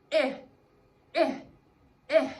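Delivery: short-I sound "ih", written "eh"; three separate, brief "ih" vowels; letter-sound demo. Shown as a demonstration. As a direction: say "eh" as the short I in inchworm. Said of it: "and he says".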